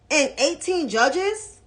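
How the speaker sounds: ordinary delivery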